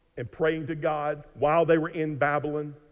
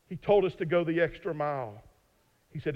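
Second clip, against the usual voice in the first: ordinary talk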